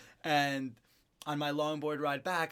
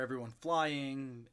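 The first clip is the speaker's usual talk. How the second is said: usual speaking voice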